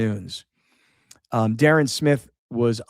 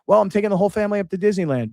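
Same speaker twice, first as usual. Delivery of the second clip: quoted reply; 7 semitones higher, much faster than usual. Retold or imitated. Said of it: "quoted answer".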